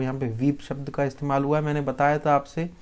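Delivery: usual speaking voice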